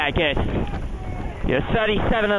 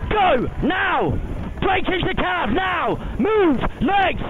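shouting; 6 semitones higher, slower than usual. Real, not acted. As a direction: bark each call to lift the crew. Cox drives the crew hard.

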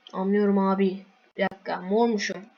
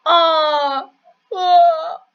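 surprised yelps; 5 semitones higher, 10 dB louder than usual. Real, not acted. Sudden surprise during fight.

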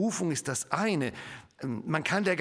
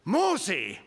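a divine summons from above; voice of God calling; 3 semitones higher, 4 dB louder than usual. Acted. God calling Moses by name, solemn and commanding. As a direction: call the name out, solemn and higher. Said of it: "Und so sagt Gott hier".

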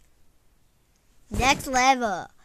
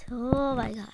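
ordinary delivery

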